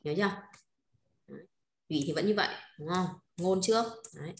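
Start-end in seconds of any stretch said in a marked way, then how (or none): none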